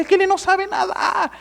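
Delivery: high-pitched imitation of the son; comic high kid voice; son's voice, imitated. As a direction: voice the son blurting a confession. Retold son's alarming confession.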